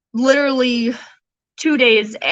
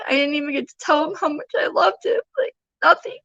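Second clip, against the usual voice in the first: high, choked voice on the edge of sobbing; tearful grief; 6 semitones higher than usual. Real, not acted. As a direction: grieve aloud, fighting back real tears. Holding her dead son, no goodbye.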